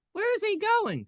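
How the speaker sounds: high squeaky voice asking after the host; squeaky imagined viewer. Pretend. Imagined viewer asks where the host is going, high squeak. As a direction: pretend fan voice, high and puzzled.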